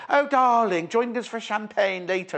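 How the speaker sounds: high, hammed-up theatrical darling; off-air luvvie actor. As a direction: gush like a posh actor calling friends over for drinks. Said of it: "come off air and go".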